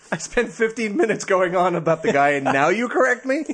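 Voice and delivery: high-pitched, playfully outraged protest; mock indignation. Presenter to co-host who corrected him late, mock indignant. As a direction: complain in mock exasperation, pitch climbing.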